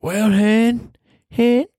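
high, twangy Southern "hun, hun"; deep south accent. Acted. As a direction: lay on the Alabama drawl. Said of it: "more deep Southern accent".

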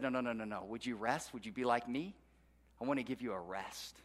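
soft, hushed and tender, like calming an exhausted worker; gentle voice of God. Acted. God gently urging weary slaves to rest, softly and tenderly. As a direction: softly invite them to rest.

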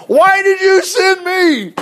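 high, loud, drawn-out wail; stretched, over-the-top yell of complaint; anguished mock shout. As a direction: cry out to heaven in hammed-up exasperation. Acting Moses' outburst at the Lord.

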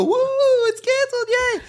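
high excited nerdy cheer. Retold or imitated. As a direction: squeal with joy. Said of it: "We go".

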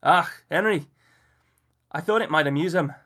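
put-on playful Scottish accent. A narrator's reading. Miller answers Henry in Scottish accent. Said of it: "flourishing another accent".

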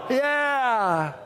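long, high falling yeah; drawn-out mock cheer; cheering crowd. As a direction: imitate a roaring studio audience. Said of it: "And the crowd would go".

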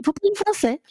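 high, bright surprised question; kind stranger's question. Retold or imitated. Senegalese lady greeting a lost newcomer, high and friendly. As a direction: lift it up like a friendly rescue. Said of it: "Et elle me dit".

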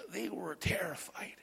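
storyteller's whisper; hushed whisper. Acted. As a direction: whisper it, slow and breathless. Dramatic hush in Bible story.

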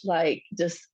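ordinary talk, nothing marked

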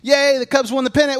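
high hammed-up whoop; mock excitement. Describing shallow joy, high mock whoop. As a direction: cheer like a fan, overdone. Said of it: "kinda like".

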